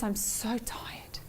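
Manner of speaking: soft, slow, breathless whisper of fatigue; a drained inner voice sighing to itself; whispered weary moan. Retold or imitated. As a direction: let it fade to a tired whisper. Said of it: "Who has that voice saying".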